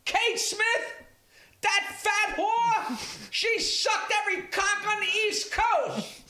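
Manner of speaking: a shrill ringside heckler yelling. Retold or imitated. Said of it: "the guy sitting ringside goes".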